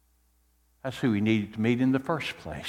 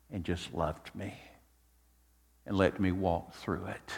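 soft, low, reverent gratitude; tender testimony; 6 semitones lower, 9 dB quieter than usual. Acted. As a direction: lower the voice and confide it with gratitude. Psalmist's grateful testimony to God.

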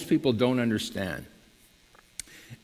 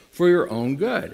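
ordinary delivery

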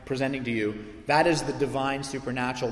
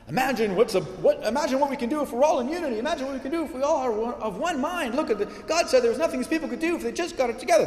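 much higher, fast, laughing hype-sermon voice; mock a gushing preacher selling unity, giggling through it; mocking hyped preacher; 9 semitones higher, faster than usual. Pastor mocking other sermons on Babel, high fast laughing hype. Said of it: "I've heard sermons and I've preached sermons".

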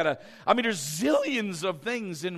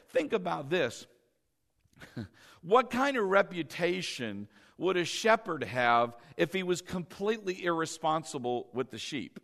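normal talking voice

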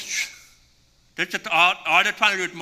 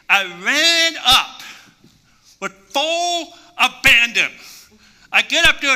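louder, higher, hyped retelling; excited; 7 semitones higher, 6 dB louder, slower than usual. Retold or imitated. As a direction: ride the rush of running back up. Excited retelling of second jump.

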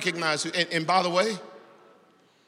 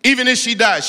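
loud, higher repeat of "Even if she dies"; loud cry; 11 semitones higher, 13 dB louder than usual. Acted. Preacher repeating the prayer line, loud and high.

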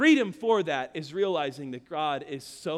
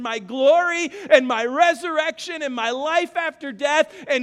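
loud, higher preached rebuke; God rebuking; 10 semitones higher, 9 dB louder than usual. Acted. God confronting the believer, loud and higher. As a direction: thunder it as God's own voice. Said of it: "That's what he's saying is".

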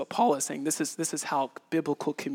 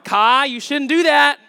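warning to son; 9 semitones higher, 13 dB louder than usual. Retold or imitated. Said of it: "and I'm like".

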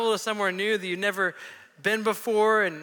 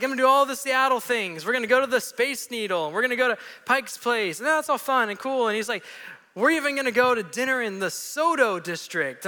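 excited tourist; 4 semitones higher than usual. Retold or imitated. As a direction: bubble over with plans. Voicing Wayne's excitement.

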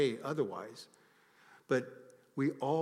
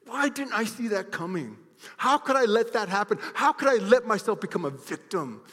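loud, high anguished questions; self-reproach; 10 semitones higher, 11 dB louder than usual. Retold or imitated. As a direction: cry out in frustration at yourself. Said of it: "They say".

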